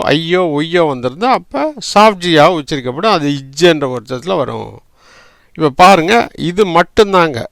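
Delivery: ordinary talk, nothing marked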